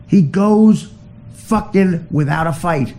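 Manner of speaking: normal talking voice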